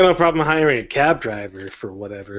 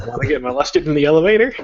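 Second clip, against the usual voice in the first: mock sales pitch; 4 semitones higher, faster than usual. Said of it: "that's the sales pitch headline".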